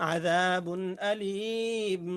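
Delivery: آযাবুন আলীম drawn out very slowly, each sound clear without nasal hum; clear recited reading. Shown as a demonstration. Teacher demonstrates izhar to students, slow clear recitation. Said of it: "গুণনা ছাড়া স্পষ্ট করে পড়িতে হয়".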